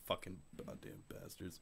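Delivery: barely audible whispered cursing; whisper under breath. Acted. Grumbler cursing shoppers in the aisle, whispered under breath. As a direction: swear secretly under your breath. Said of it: "whisper under your breath".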